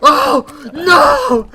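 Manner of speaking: retold cry of disbelief, building to a shout; disbelieving yell. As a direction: cry out as if you can't believe your own play. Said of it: "you can see me, I go".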